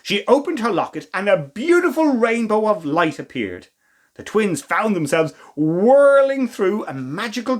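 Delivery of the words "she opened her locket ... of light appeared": ordinary delivery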